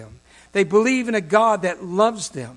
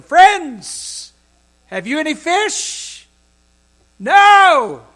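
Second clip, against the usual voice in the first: higher and louder, calling across the water; 8 semitones higher, 12 dB louder, much slower than usual. Acted. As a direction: call out to the boat from the shore. Jesus calls out from shore.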